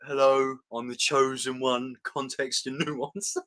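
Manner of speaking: lightly put-on voice, slow at the end; mocking impersonation. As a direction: impersonate a friend, drawn-out and deliberate. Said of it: "that sounded like me impersonating you".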